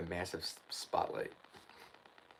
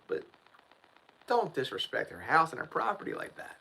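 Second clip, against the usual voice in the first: ordinary delivery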